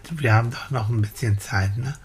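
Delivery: ordinary delivery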